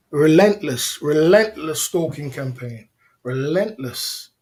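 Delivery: "Relentless" drawn out slow and weighty; emphatic denouncing. Real, not acted. Streamer accusing his rival of stalking him, emphatic denouncing. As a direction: hammer "Relentless", stretching it out.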